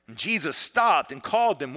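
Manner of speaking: ordinary talk, nothing marked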